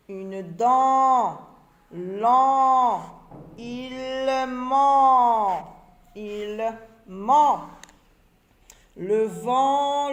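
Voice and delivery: each word loud and separate, with a pause after each; teacher's reading drill. Shown as a demonstration. Teacher models the word list for the class, loud and clear. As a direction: read the list out clearly for the children.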